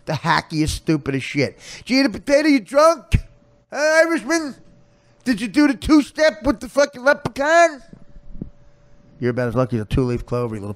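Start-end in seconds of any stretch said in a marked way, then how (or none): 1.8-7.8 very high, loud Irish caricature. Retold or imitated. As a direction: hammer the hacky Irish clichés in a squeal. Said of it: "And then you had".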